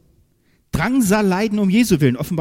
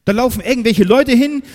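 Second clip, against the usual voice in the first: ordinary talk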